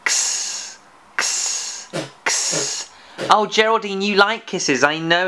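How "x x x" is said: a soft voiceless "x" said as /ks/, three times; x sound demo. Shown as a demonstration. Teacher showing Geraldine the "x" sound, soft and voiceless. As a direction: breathe out a gentle /ks/ for "x", like blowing a kiss. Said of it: "It's a bit like blowing a kiss".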